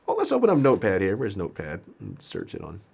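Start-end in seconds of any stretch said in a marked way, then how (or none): none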